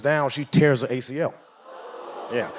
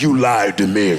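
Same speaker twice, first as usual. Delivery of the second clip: bladder's complaint; 6 semitones lower, 4 dB louder than usual. Pretend. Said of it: "your bladder's like".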